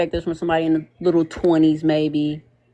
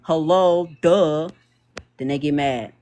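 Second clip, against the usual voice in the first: normal talking voice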